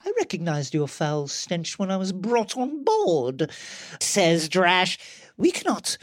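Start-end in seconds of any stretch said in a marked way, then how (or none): 0.0-3.5 put-on posh Star Wars British accent; English accent. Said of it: "English accent". 5.4-6.0 put-on high-pitched posh British accent; dramatic battle cry in posh British English. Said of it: "English accent".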